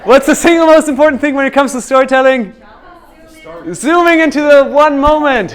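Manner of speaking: loud, high, energetic projection; calling out. Real, not acted. Coach quizzing the class on storytelling, calling out loudly. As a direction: fire the question out to the whole room.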